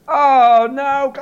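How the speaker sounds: loud, high wail of dread; mock dismay. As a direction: cry out in comic despair. Acted dismay at the news.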